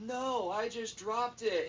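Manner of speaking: put-on high, drawn-out kid voice; whiny kid denial. Kid denies throwing the iPad, high whine. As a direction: plead innocence in a high, slow whine.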